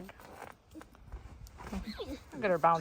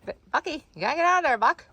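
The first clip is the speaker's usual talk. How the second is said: higher, raised shoo-out call; calling out to goat; 8 semitones higher, faster than usual. Real, not acted. Shooing goat out of spot.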